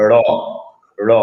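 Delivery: ড়-like র with the tongue curled back; retroflex r demo. Shown as a demonstration. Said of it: "জিহ্বা উল্টে". (জিভ উল্টে ড়)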